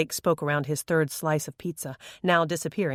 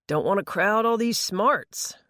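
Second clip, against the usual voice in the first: slow, higher, cocky boast; boastful teen; 5 semitones higher than usual. A narrator's reading. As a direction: show off your brains. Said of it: "he claimed".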